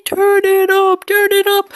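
very high, loud falsetto chant, slowed; hyped falsetto shout. Pretend. Urging viewers to crank the volume, shrieking in falsetto. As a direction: shriek it like a cartoon fan. Hyping the speakers to max.

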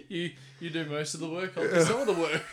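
laughing through a teasing line. Real, not acted. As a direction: tease him while cracking up. Joking, laughing.

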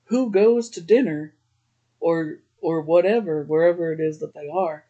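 ordinary delivery

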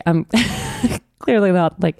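ordinary talk

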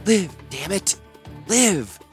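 will her back to life; desperate command. A narrator's reading. Healer pounding her friend's chest, desperate cry.

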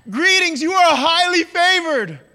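high, loud angelic announcement; exuberant, booming salutation; overexcited greeting. Acted. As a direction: gush the good news with too much energy. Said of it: "Why are you too excited?".

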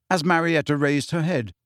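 ordinary delivery, nothing marked

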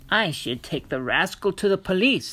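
irate apple seller threatening the police; angry old woman yelling. A narrator's reading. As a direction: yell at the rascal like a cross granny. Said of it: "the apple woman yelled".

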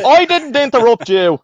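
high, loud squawk of protest; mock outraged shout. Storyteller scolding his interrupting friend, mock outraged shout.

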